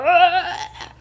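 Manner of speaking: high startled scream. Retold or imitated. Woman bursting from the dirt screams at Laura. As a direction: shriek as the hand grabs you. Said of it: "grabbing onto her arm and going".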